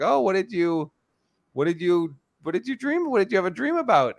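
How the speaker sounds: rising high, eager coo; excited baby talk. Retold or imitated. Mom eagerly asking her daughter what she dreamed, high coo. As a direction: draw the toddler out sweetly. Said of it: "And I was like".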